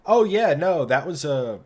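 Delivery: high, bright customer-service voice; sing-song salesy dealer reply; chirpy dealer voice. Retold or imitated. Quoting dealership staff.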